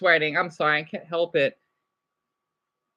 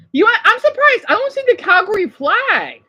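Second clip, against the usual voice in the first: much higher, louder exclamation; surprised; 10 semitones higher, 4 dB louder than usual. Real, not acted. Vlogger noticing no Calgary flag, high surprise. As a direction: sound genuinely caught off guard.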